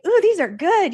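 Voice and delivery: high, delighted ooh. Retold or imitated. Tasting the bartender's mocktails, high and delighted. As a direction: savour it with delight.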